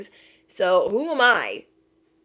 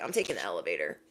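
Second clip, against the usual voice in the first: low deadpan retort; 12 semitones lower, 9 dB quieter, much faster than usual. Retold or imitated.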